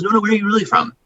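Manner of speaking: a hint of a pushy news anchor; mock insistent anchor. News anchor pressing her guest on where he is really from, mock insistent.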